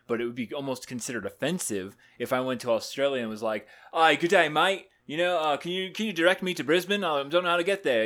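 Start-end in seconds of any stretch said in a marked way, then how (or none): 3.9-8.0 loud, higher-pitched mock Australian; hammed-up Australian accent asking directions. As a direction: put on a broad Aussie tourist accent. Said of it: "because I'm speaking with an accent".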